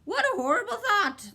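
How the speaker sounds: high, loud shudder of disgust. A narrator's reading. Bird horrified at going home, high shudder. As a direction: recoil at the thought. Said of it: "the bird shuddered".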